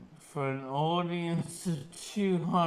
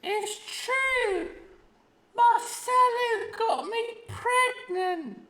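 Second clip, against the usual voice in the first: high, put-on woman's voice; quoting the woman. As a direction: read her quote in her voice. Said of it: "say this without laughing".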